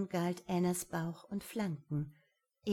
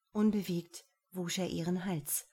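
ordinary delivery

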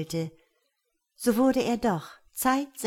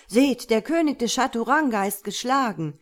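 loud excited crowd cry; calling out; 4 semitones higher, 5 dB louder than usual. A narrator's reading. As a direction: announce it loudly to everyone. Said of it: "So ging der Ruf durch die Reihen".

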